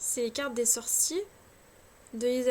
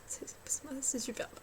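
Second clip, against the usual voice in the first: whispered aside; 10 dB quieter than usual. Acted. Mock self-praise aside.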